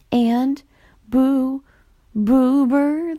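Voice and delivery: nervous, stuttering ghost voice; stammering ghost. A narrator's reading. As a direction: stumble on the name.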